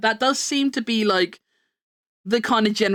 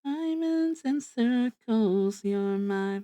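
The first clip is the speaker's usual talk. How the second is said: soft slow sing-song; 6 dB quieter, much slower than usual. Pretend. Soft slow closing line.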